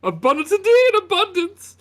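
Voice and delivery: high, loud, giggly host; beaming mayor welcoming visitors; jolly mayor. Acted. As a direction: fuss over the guests with glee. Mayor echoes the word.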